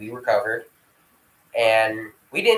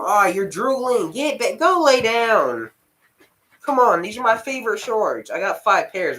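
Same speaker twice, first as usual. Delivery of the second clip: higher-pitched exasperated pet scolding; fussing at the dog; 9 semitones higher, 4 dB louder than usual. Real, not acted. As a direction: shoo the drooling dog off your shorts. Scolding puppy drooling on shorts.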